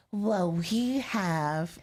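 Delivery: very slow, bubbly show host; sweet, bubbly intro voice, drawn out; super bubbly. Retold or imitated. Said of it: "she pretends that she's super bubbly".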